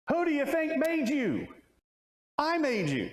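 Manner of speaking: high, ringing voice of God; indignant divine rebuttal; God's retort. Acted. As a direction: fire back as God, who made you.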